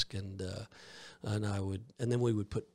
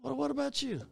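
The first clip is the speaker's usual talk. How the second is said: squeaky question. Retold or imitated. Voicing people in heaven.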